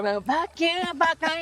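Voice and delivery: lightly voiced angry grandma; high-pitched mock cussing. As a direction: cuss them out in a high voice. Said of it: "she's like".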